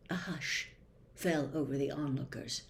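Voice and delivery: plain reading voice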